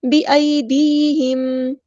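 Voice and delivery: slow, drawn-out Quranic recitation; Tajweed recitation. Shown as a demonstration. Teacher models the verse word, slow Tajweed recitation. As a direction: recite slowly, holding each long vowel.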